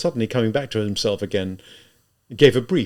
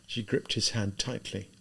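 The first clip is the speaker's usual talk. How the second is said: plain reading voice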